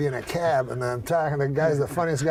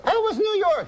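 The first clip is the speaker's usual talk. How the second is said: very high falsetto wife; high woman's voice. Acted. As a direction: pipe up as the uncle's wife. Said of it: "She's a woman, high voice".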